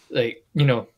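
ordinary delivery

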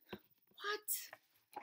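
a breathy stunned what; shocked whisper. Acted. Teacher reacting to the silly line, whispered what. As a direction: whisper it in disbelief.

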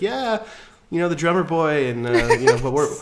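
put-on high, loud gushing young woman voice; naive heroine. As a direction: chirp it like a starry-eyed girl. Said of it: "She's like".

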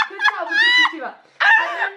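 high, squealing words through real laughter; can barely get it out for laughing; laughing hard. Real, not acted. Laughing at her own drawing.

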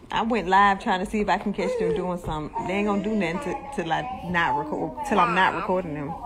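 ordinary delivery

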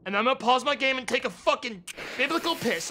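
ordinary delivery

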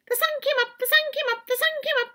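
high chirpy chipmunk chant; high chipmunk singsong. Acted. Chipmunk's song.

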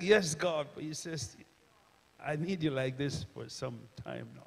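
normal talking voice

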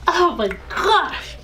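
high, slow, drawn-out gasp; stunned. Real, not acted. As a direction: stretch it in disbelief. Reaction to sour powder.

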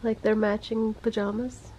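ordinary delivery

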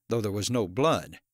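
ordinary delivery

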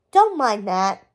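ordinary delivery

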